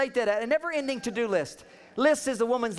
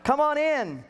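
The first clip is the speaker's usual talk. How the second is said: a touch higher, lightly welcoming; mock inviting voice; 3 semitones higher than usual. Sign's Venusian reading in a lightly mock inviting voice. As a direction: invite them in, overly friendly. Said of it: "In Venusian, it says".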